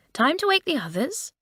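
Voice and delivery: high, singsong schoolgirl call; lilting, meant to be overheard; carrying voice. A narrator's reading. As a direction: pitch it up so it carries. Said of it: "said Lucy in a carrying voice".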